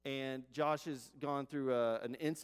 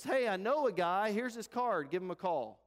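a hint of a breezy passerby, louder and higher; lightly acted raised-voice reply; passerby calling down; 7 semitones higher, 7 dB louder than usual. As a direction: call down to someone below. Passerby calls down into hole.

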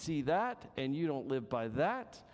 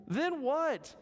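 high curious question, lightly voiced; a touch of puzzled man asking; voicing the man; 12 semitones higher, 3 dB louder than usual. Preacher voicing the man quizzing the dog, high and curious.

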